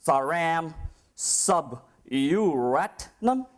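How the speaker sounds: "Saram Sub-Uratnam" drawn out very slowly, syllables split as Sub-U-rat-nam; mispronounced name. Retold or imitated. Immigration officer at the airport butchers the speaker's name, slowly. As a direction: stumble slowly through "Saram Sub-Uratnam" with a questioning rise. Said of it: "that mispronunciation".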